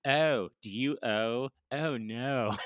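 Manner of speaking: imitating a hammed-up Maryland accent, vowels drawn out; slow, exaggerated Maryland accent. Comedian quoting a sitcom ad switching into Maryland accent, hammed-up. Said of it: "before I lost my Maryland accent".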